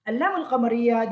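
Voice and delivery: normal talking voice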